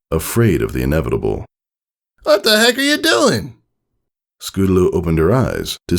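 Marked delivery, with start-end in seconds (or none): 2.2-3.6 high, baffled coach voice; Rainbow Dash voice. A narrator's reading. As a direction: ask it in puzzled disbelief. Dash questions falling filly.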